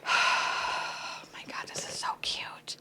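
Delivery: slow, very soft whisper; sigh, then whisper it in wonder; awed whisper. Real, not acted. Collector awed by the cute set, whispering.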